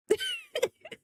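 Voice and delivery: squeaky burst of laughter; amused high-pitched laugh. Real, not acted. Laughing at drawing comment.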